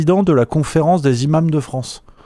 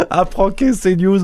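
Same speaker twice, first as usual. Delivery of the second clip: laughing through it; 6 semitones higher, slower than usual. Real, not acted. Still recovering from the laughing fit.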